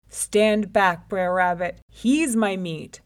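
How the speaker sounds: loud, possessive warning; commanding lion. A narrator's reading. Lion claims his prey from rabbit, commanding. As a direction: order him aside forcefully.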